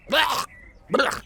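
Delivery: high disgusted blech; gagging blech. A narrator's reading. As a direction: gag at the thought. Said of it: "Awesome Blitz gagged".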